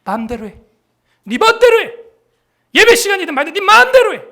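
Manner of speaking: put-on fed-up father telling the kids to do as they please; angry dad. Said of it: "아빠가 그럴 거야".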